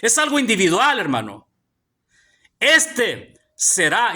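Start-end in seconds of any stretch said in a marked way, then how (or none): 2.6-3.3 "Este" drawn out, first E stressed. Shown as a demonstration. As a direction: lean long on the opening E of "Este". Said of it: "tiene un acento en la E". ("Este" con la primera E acentuada y alargada)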